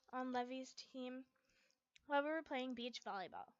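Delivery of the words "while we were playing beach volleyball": ordinary delivery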